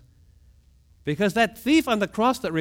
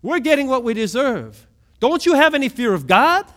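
higher, louder stern rebuke; rebuking shout; 5 semitones higher, 6 dB louder than usual. Acted. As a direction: silence the mocker hard. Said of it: "a repentant thief who said".

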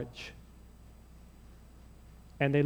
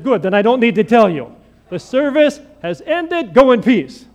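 loud, higher, slowed closing line; triumphant dismissal; 4 semitones higher, 8 dB louder than usual. Retold or imitated. Seminarian ending chapel early, loud and triumphant. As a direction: declare the service over with a grin.

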